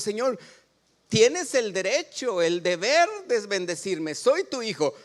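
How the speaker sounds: high, loud claim on God as his son; demanding prayer. Pretend. Preacher voicing a bold believer demanding blessing, high and loud. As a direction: lay claim boldly, like a child owed a blessing. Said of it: "Estamos reclamándole a Dios".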